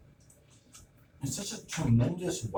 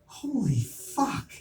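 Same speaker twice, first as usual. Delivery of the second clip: much higher, very slow and stretched; stunned disbelief; 10 semitones higher, much slower than usual. Retold or imitated. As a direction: let it drag out in shock. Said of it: "I was like".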